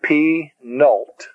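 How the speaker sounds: Penult slowly split as pe... nult; syllable by syllable. Shown as a demonstration. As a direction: break Penult into two drawn-out syllables. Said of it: "is called the penult".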